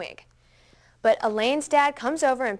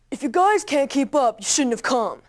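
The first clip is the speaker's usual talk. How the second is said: mocking older brother; 2 semitones higher, 5 dB louder than usual. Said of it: "made this big deal about it".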